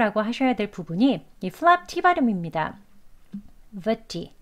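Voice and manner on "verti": verti with a crisp, released T; hard T. Shown as a demonstration. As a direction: model verti slowly as a lone word, true t sound. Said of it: "verti에서".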